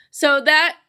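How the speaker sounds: ordinary talk, nothing marked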